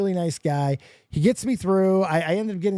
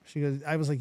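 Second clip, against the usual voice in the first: ordinary talk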